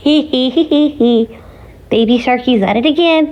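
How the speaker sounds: high put-on giggle, then a teasing line; mock evil cackle. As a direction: cackle like a mischievous cartoon villain.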